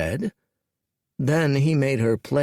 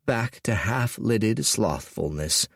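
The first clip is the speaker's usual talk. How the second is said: usual speaking voice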